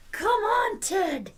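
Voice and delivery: high coaxing call. Real, not acted. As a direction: coax the puppy along.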